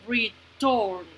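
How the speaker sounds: "return" broken as re, then turn; syllable by syllable. Shown as a demonstration. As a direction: pause between the two syllables of "return". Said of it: "escuchen cuántas sílabas".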